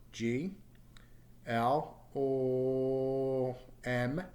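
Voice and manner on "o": "O" as a long, held voiced L hum; voiced consonant demonstration. Shown as a demonstration. Teacher shows sounds that vibrate, voiced consonant demonstration. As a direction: hold "O" steadily as a bare L sound. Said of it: "examples ... under the other sounds".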